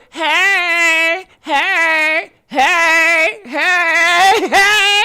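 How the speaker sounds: loud, high, stretched-out heys; playful yelling. Shown as a demonstration. Streamer who has fun yelling lets loose, playful shouts.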